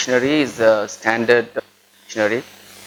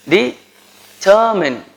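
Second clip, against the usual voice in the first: Determine drawn out very slowly, high pitch, stress on TER; Determine stretched syllable by syllable, de-TER-mine; slow model Determine; slow stressed demo; 7 semitones higher, 3 dB louder, much slower than usual. Shown as a demonstration. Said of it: "Stress and intonation".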